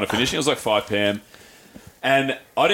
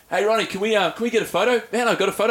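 higher, cheeky mate voice; chirpy ask for a photo; pitched-up mate asking Ronnie Coleman for a photo; mate's cheeky ask; 8 semitones higher than usual. Retold or imitated.

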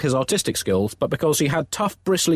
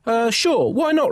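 hesitant Pope; 10 semitones higher, slower than usual. Acted. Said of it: "replied the pontiff".

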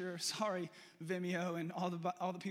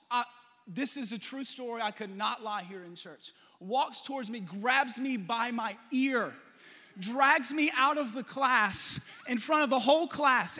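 usual speaking voice

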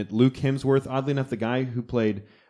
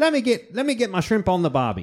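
bad Australian accent; 9 semitones higher than usual. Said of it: "I don't even know how to do an Australian accent".

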